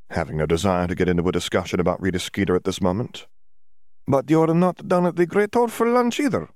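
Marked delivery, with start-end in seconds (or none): 4.1-6.5 much higher, put-on foreign student voice; Dimitri's voice. A narrator's reading. Dimitri quizzing Hermione, voiced high and foreign. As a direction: play him as a cheery visiting student.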